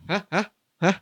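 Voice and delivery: hiccuping. Real, not acted. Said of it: "Saya cegukan, Mas".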